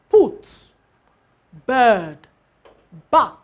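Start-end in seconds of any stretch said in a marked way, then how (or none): 0.1-0.5 "put" said high and loud; model word. Shown as a demonstration. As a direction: call out "put" as an example. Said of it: "some more examples like".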